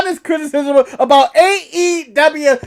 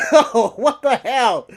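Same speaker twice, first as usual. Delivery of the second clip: usual speaking voice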